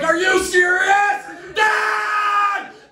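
high, loud, slow shout; road rage yell. Retold or imitated. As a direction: yell at the slow driver ahead. Yelling at slow driver.

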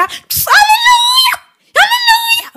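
high, drawn-out hallelujah cry; mock preacher cry. Mocking the pastor-suspect with a sung-out preacher's alléluia. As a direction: wail it like a revival preacher, mocking.

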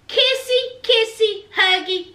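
high singsong that steps down; mock-cutesy, word by word; teasing lovey-dovey. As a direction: tease the couple with playful baby-talk. Teasing the couple's making up.

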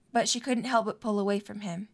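ordinary talk, nothing marked

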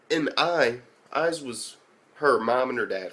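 usual speaking voice

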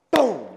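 loud sharp boom for the slam; a roommate slamming the door, a big loud boom; shouted boom. Retold or imitated. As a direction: belt the sound of the door.

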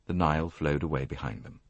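ordinary delivery

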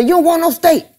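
high mock woman voice; imitating Dirty. Dirty South teasing the narrator about steak, in a high mock woman voice. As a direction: pitch it up like a teasing lady friend.

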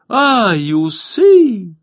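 high, loud startled shriek of the bathing women; frightened shriek. A narrator's reading. Bathers spotting the hidden Cossack, shrieking in fright. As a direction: squeal in sudden fright at the moustache. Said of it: "закричали вдруг некоторые".

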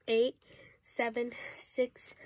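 normal talking voice

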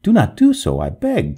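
urgent plea, jumping high and loud; alarmed, high-pitched protest; pleading cry. A narrator's reading. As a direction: beg them urgently to stop. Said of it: "cried the ghost".

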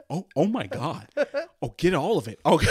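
loud, laughing ecstatic sigh of relief; blissful relief. Retold or imitated. Telling Sam about finally cleaning his ears, loud and ecstatic. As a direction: gush like the best feeling in the world. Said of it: "the most satisfying fucking feeling".

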